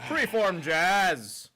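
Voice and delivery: slow, loud, high drawn-out announcement; slow announcer. Acted. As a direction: drawl it like a pompous announcer.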